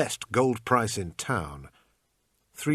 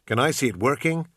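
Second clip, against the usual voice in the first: bad American accent; 5 semitones higher, 5 dB louder than usual. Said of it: "in my usual bad American accent".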